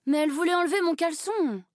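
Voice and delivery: enfant indigné, plus aigu et plus fort; garçon qui s'exclame. A narrator's reading. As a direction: proteste comme un petit garçon outré. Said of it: "s'exclama Pietro". (exclamation indignée d'enfant)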